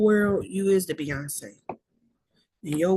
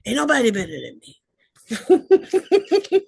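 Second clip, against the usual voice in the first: imitating [name]; 5 semitones higher, 5 dB louder, slower than usual. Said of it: "he was like".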